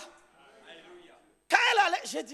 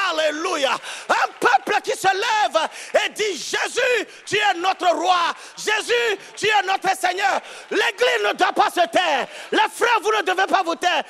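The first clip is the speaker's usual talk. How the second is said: loud, driving sermon cadence; fiery preaching; 7 semitones higher, 10 dB louder than usual. Acted. Pastor rallying the congregation, preaching at full force. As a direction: preach it out to the whole church.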